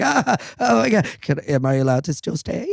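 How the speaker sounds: high, loud flustered plea; panicked and begging to stay; mock-panicked newcomer. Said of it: "And I was like".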